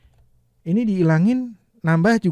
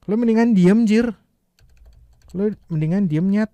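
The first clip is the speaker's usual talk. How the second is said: louder, higher rude snap at a friend; rough angry demo; 5 semitones higher, 6 dB louder than usual. Shown as a demonstration. Teacher shows the rude Jakarta version, louder and higher. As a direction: snap it rudely like a mate. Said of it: "Kita ganti sama yang lebih kasar".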